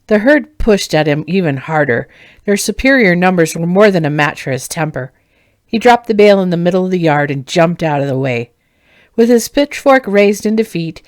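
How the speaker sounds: ordinary delivery, nothing marked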